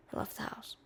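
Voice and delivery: whispered, no voice. Shown as a demonstration. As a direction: breathe the words out.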